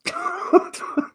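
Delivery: amused outburst at the cat voice; laughing. Real, not acted. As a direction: laugh while asking. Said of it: "what's with the voice".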